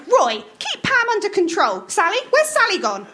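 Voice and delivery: bossy wife snapping at Roy; Maud scolding. A narrator's reading. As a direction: nag him to hurry up. Voicing Maud.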